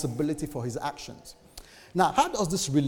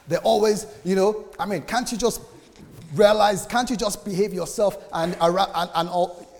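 exasperated rant; 6 semitones higher, 6 dB louder than usual. Retold or imitated. Venting about rude people.

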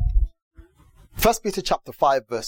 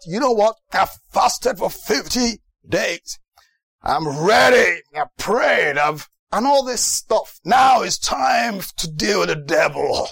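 forceful, drawn-out preacher impression; fired-up preacher; 3 semitones higher, slower than usual. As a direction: build to a battle cry, imitating a showy preacher. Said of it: "the impression he gave was that".

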